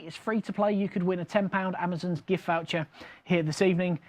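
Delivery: ordinary talk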